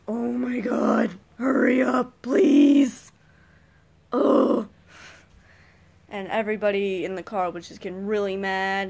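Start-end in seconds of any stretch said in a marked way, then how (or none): 0.1-4.7 loud, rising, slow shout at the driver; road rage yell. Retold or imitated. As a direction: yell at a crawling car ahead. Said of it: "And I'm like".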